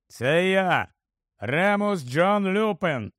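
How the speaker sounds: slow, drawn-out call from outside; calling through wind. A narrator's reading. Lupin announces himself through the howling wind, called out slowly. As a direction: make the name carry over a howling wind. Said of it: "пролунав крізь завивання вітру голос".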